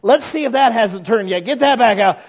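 lightly voiced father hunting for leftovers; mock stingy father. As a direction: play the cheapskate dad offering old freezer meat. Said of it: "He didn't say".

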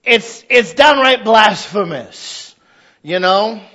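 ordinary talk, nothing marked

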